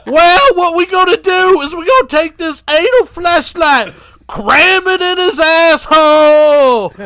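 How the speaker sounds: Sick Ripper voice. Retold or imitated. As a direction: squeal it like a giddy maniac.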